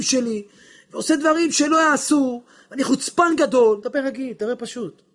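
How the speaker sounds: impassioned sermon plea; fiery preaching. Acted. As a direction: preach it with full passion. Preaching at full force.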